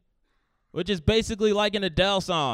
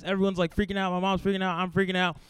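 ordinary talk

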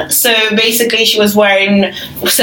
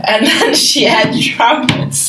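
laughing; 6 semitones higher than usual. Real, not acted. Laughing recap of trumpet look.

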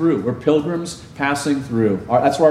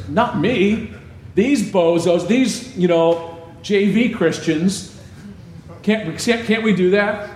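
higher cocky boast; Peter, cocky; 5 semitones higher, slower than usual. Acted. Peter boasting to Jesus that the others will run, cocky and high. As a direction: brag like the one who'd never fail. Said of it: "Not loose paraphrase".

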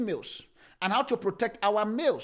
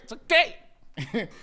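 "It's okay" stretched very slowly and lazily; drawn out; 4 semitones higher, much slower than usual. Real, not acted. Pastor teasingly refusing to reveal the idea, drawn out. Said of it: "I ain't gonna say it".

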